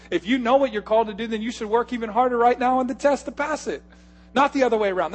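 loud, high, driving sermon push; full-force preaching. Acted. Pastor scolds lazy young believers, loud full-force preaching. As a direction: hammer it home to the congregation.

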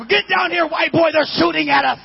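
high, loud warning shout; neighbors yelling. Retold or imitated. Neighborhood men warning the kids of gunfire, loud yelled warning. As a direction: yell at the kids to duck.

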